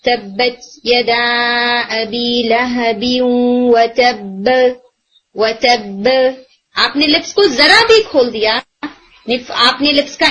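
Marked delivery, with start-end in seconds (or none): 0.0-3.0 slow, drawn-out Quranic recitation; slow tajweed recitation of the verse; Quran recitation demo. Shown as a demonstration. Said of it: "جیسے وہ سورۃ اللہب کے and میں آتا ہے". 3.2-6.1 و تب said with a light bounce on the final ب, lips staying closed; qalqala demo. Shown as a demonstration. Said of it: "اگر آپ بولتے ہیں".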